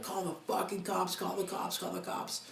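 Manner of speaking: high urgent repeated plea; urgent pleading. Retold or imitated. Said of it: "I'm like".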